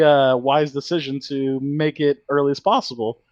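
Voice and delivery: ordinary talk, nothing marked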